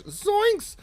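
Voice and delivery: squeaky cartoon yelp, very high; a goofy cartoon yelp; Shaggy zoinks. Reading chat, hams up a Shaggy yelp.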